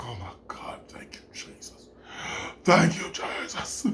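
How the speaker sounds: relieved whisper. Retold or imitated. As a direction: breathe a grateful whisper. Relief after finding the ID.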